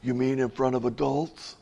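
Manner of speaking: retold nervous young preacher, hushed; nervous reply.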